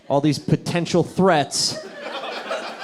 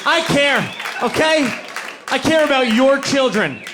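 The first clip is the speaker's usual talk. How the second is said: mock urgent rant; 9 semitones higher, slower than usual. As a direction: plead wildly, over the top. Mock counselor ranting urgently.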